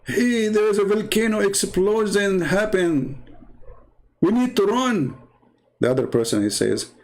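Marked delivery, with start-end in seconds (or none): 0.0-5.2 urgent warning. Retold or imitated. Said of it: "Like somebody telling you".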